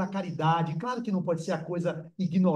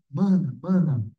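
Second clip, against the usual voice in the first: lower, louder voice of a dead uncle calling his sister; imitating the dead uncle; 4 semitones lower, 3 dB louder than usual. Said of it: "Tô imitando a voz dele". (imitando a voz do tio, mais grave)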